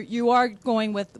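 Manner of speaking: ordinary talk, nothing marked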